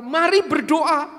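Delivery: loud, high angry command. Retold or imitated. Angry church member ordering the pastor to pray, loudly. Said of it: "Dalam kemarahannya itu lalu berkata".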